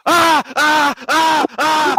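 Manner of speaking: high loud yelping ah ah; startled yelps. Retold or imitated. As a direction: yelp in shock, high and loud.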